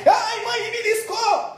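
put-on high-pitched child whining to mum; acted child's cry of pain; pinched child's yelp. Child to mother after a pinch, whiny high yelp.

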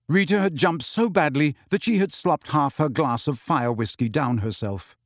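ordinary delivery, nothing marked

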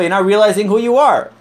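ordinary talk

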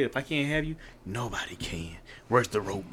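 low, quiet, ominous threat; menacing ex. Acted. Co-host imagines the rejected boyfriend's reply, low and menacing. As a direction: voice a chilling ex under your breath. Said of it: "what if he would have said".

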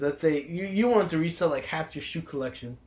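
usual speaking voice

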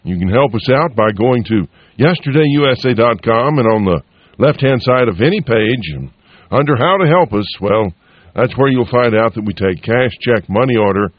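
usual speaking voice

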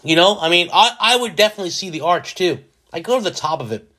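ordinary delivery

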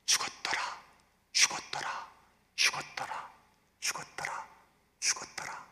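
breathy whisper repeating "죽었더라", fading out; fading whispered refrain. Shown as a demonstration. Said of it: "놀란 장면이 있습니다".

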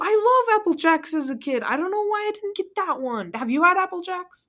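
high-pitched wail of regret; dismayed high voice. Real, not acted. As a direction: complain way up high in playful dismay. Dismayed at missing favorite cereal.

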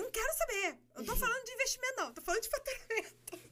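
high and fast, words tumbling out; telling her husband off in a fast, thrilled rush; euphoric. Retold or imitated. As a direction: rattle it off, too excited to listen. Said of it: "naquela euforia".

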